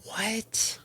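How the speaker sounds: high, soft, stretched rising what; a high, hushed what of disbelief, imitated; shocked what. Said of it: "I was like".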